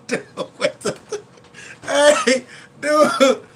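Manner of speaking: high, loud words through crying laughter; can barely talk for laughing; real helpless laughter. Real, not acted. Laughing hard at movie memory.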